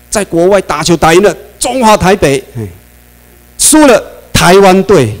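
mocking irony. Mocking how media name the team.

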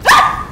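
very high shriek breaking into a laugh; scream, then laugh it off. Real, not acted. Streamer caught by monster, high scream then laugh.

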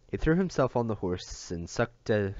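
usual speaking voice

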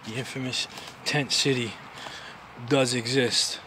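low, soft aside to the camera; quiet. Real, not acted. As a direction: murmur it to yourself. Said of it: "repeats phrase louder".

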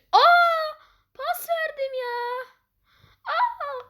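very high cries of dismay; anguished wail. Real, not acted. Gamer wailing after passing the ball away, high anguish.

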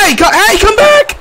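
very high, loud call. Real, not acted. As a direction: yell after it to come back. Calling after a fleeing creature.

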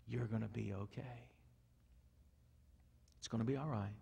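gently calm a frightened animal; shepherd soothing the cast sheep; calming, reassuring. Acted. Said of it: "in a tone and a voice that is calming and reassuring".

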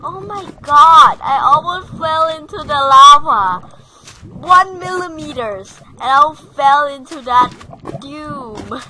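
loud, high panicked yells; terrified shouting. Real, not acted. Nearly falling into lava, terrified shouting. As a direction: scream it after nearly falling. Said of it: "I'm so terrified".

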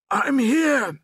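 high, loud distant call. A narrator's reading. Father calling to his son from a pit, calling out. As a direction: holler so you can be found. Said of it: "the voice called out".

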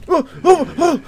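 high, loud startled cry; sudden scared shriek; startled yelp. Real, not acted. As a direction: jump out of your skin. Jump scare at the door.

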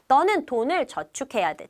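normal talking voice

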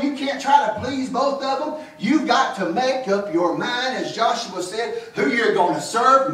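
fervent sermon, pressing the congregation; preaching. Acted. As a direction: preach it out with full conviction.

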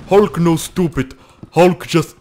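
loud, slow caveman-like Hulk talk; Hulk impression. Gamer playing as Hulk mimics him, loud dim Hulk voice. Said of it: "is that a Hulk impression?".

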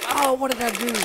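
high, loud outburst of alarm; startled dismay. Real, not acted. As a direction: cry out at a sudden mistake. Startled by citation in the game.

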